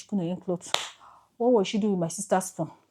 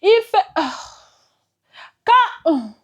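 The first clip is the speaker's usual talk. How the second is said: high, loud cry breaking off; calling out for her; 12 semitones higher, 14 dB louder, much slower than usual. Real, not acted. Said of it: "I still go about and say".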